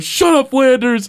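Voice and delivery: irritated cartoon dad brushing off the neighbor; Homer impression. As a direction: snap it back at the neighbor in a high put-on voice. Homer snaps back at Flanders.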